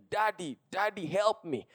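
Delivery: soft, imitated child's plea; understated cry for Daddy; retold crying out. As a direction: quote a daughter calling weakly for help. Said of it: "She's crying out".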